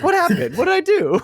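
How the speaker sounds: high confused mock voice; baffled guy. Describing the character's reaction, high baffled voice. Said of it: "his reaction is like".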